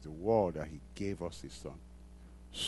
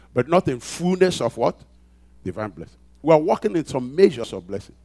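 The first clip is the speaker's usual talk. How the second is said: loud, high, driving pulpit voice; preaching at full force; 4 semitones higher, 13 dB louder than usual. Acted. Pastor rousing the congregation, preaching at full force. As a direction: build it up and hammer each phrase.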